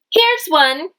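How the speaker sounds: high excited discovery; delighted here's one; excited exclamation. Shown as a demonstration. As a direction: announce it like a happy find. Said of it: "exclamation mark at the end".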